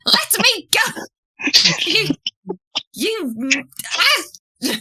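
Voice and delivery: high squawking protest; struggling gnome. Acted. Flopsy thumping Hugh's shoulder, high squawking protest. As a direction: squeal to be let go.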